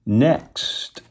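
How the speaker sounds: ordinary delivery, nothing marked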